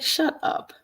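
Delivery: high whispered shut up; breathy high shut up; incredulous squeak. Retold or imitated. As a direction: squeal it under your breath in disbelief. Disbelief at friend's forwarded report.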